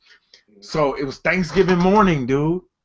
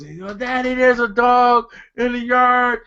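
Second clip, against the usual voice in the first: imitating alarmed son; 8 semitones higher, 3 dB louder than usual. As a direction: play a distressed kid reporting to Dad. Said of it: "So my son was".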